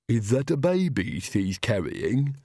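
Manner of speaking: slow servant's voice; Igor's voice. A narrator's reading. Igor pinned under Nanny asks about the baby, slow servant voice. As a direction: speak slowly as the hunched servant.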